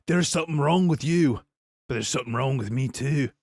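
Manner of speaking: lightly acted movie-fighter line; amateur take on a psycho's taunt; Conor McGregor. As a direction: deliver a menacing film line, half-hearted imitation. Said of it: "way better than I just did".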